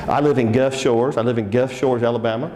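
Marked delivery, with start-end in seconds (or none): none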